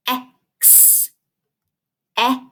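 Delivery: "X" broken into eh and a long hissed ks; "X" in separate sounds, the ks held long; sounds tapped out. Shown as a demonstration. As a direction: sound out "X" slowly, eh... ksss. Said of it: "let's tap out each syllable separately".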